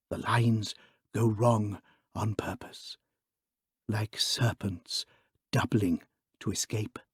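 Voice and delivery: slow fade down to a whisper. Acted. Priest explaining the evil letters to his friends, fading into a whisper. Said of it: "his voice growing lower and lower".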